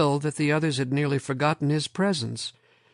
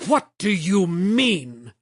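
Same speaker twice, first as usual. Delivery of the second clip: raised, slowed shout, higher and louder; angry shout; 6 semitones higher, 5 dB louder, slower than usual. A narrator's reading. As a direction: snap it back, outraged. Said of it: "Lomax shouted".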